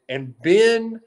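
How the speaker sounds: ordinary talk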